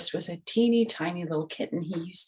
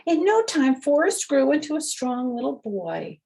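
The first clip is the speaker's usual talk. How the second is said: much higher and louder, cheerful storybook voice; reading to children; 10 semitones higher, 5 dB louder than usual. A narrator's reading. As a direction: announce it proudly to the little listeners. Reads the picture book aloud.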